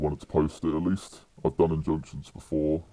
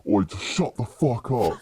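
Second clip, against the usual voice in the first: slow, higher-pitched mock shout; imitated fed-up retort; 6 semitones higher than usual. Fed-up climber to the screaming guard, imitated slow retort. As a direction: retell a climber snapping, amused. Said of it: "he's had enough of the screaming".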